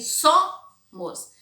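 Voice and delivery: "Somos" split as SO-mos, first syllable high and loud; "Somos" slowly by syllable, stressed on the first; syllables separated. Shown as a demonstration. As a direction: punch and hold "So" in "Somos", then drop "mos". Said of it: "vai ser o som".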